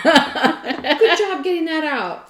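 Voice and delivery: loud, slow, high congratulations; hammed-up praise amid laughter; mock hearty praise. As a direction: cheer it out with exaggerated approval.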